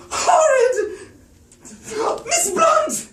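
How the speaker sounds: very high, loud, slow shriek of horror; hysterical scream. Acted. Miss Pope reporting the murder, a hysterical shriek. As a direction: shriek in horror, near tears. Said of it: "A woman screamed".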